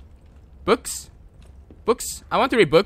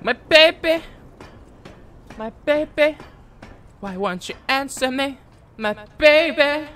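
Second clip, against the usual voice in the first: high-pitched mock lament; mimicked lamenting mother; 7 semitones higher than usual. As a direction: imitate a mother wailing for her baby. Mimicking game character's lament.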